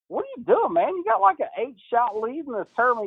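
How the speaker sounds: high, incredulous scolding; exasperated. Retold or imitated. Coach scolds player leading by eight shots, high incredulous. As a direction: plead in disbelief. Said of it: "I'm like".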